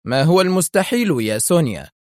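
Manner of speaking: mocking, high-arched question; sarcastic. A narrator's reading. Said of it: "أجابها أدهم في سخرية". (بسخرية)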